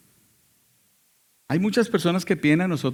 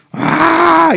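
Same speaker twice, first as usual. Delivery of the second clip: loud, high startled scream; retold scream; 13 dB louder than usual. Possessed father lunging at the woman, loud high scream. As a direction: let out a sudden loud cry. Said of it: "él hizo".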